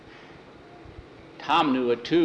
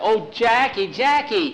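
higher and louder, relieved cry of his name; excited calling; 9 semitones higher, 6 dB louder than usual. Acted. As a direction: call out to him, overjoyed. Nancy runs out calling him.

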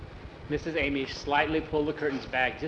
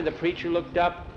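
usual speaking voice

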